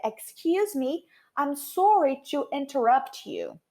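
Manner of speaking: slow, carefully spaced English apology; teacher's drawn-out demonstration; model phrase. Shown as a demonstration. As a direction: say it slowly as an example for learners.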